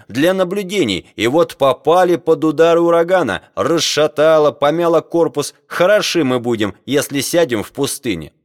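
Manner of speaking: high, indignant tirade, easing at the end; almost shouting. A narrator's reading. Captain blaming scientists before his crew, almost shouting. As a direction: rant just short of a shout. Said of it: "чуть не крича".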